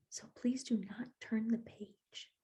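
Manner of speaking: soft, hushed whisper; whispering Grover begging the reader; whispered plea. Acted. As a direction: beg quietly so nobody hears. Small font whisper in book.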